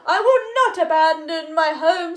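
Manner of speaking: loud, defiant frog refusal; stubborn frog shout. Acted. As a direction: dig in and shout it. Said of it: "said the frog".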